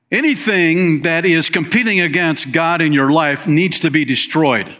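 ordinary talk, nothing marked

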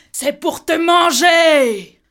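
slow, high, shouted threat; wolf's roar. A narrator's reading. Wolf reveals himself and shouts before devouring the girl. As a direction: roar it out as he pounces.

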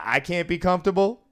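mock indignant protest. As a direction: play the wronged gambler. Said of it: "And he goes".

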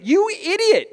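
high mock scolding; exasperated parent thinking it; scoffing at the kid; exasperated inner insult. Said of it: "I'm saying".

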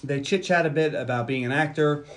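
normal talking voice

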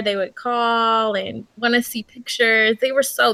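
normal talking voice

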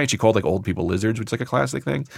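ordinary delivery

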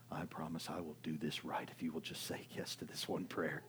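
hushed, whispery bargain with God; acted desperate whispered promise; pleading prayer. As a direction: plead softly under your breath.

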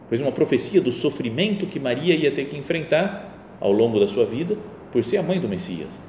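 ordinary delivery

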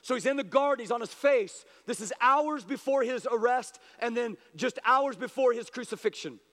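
normal talking voice

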